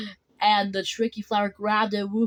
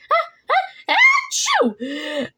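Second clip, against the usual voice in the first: high-pitched, building fake sneeze; squeaky build-up into a dropping achoo; pretend kitten sneeze; 5 dB louder, much slower than usual. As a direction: act out a big kitten sneeze.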